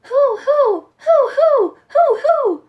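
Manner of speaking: high, loud owl hooting; loud owl hoots. A narrator's reading. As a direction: hoot out loud to scare Old Nick. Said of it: "yelled out, quick and loud".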